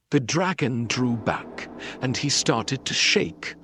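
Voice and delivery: ordinary delivery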